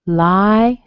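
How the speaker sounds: Lie punched out loud on its own; strong syllable. Shown as a demonstration. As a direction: hit Lie hard and hold it. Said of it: "strong on the lie".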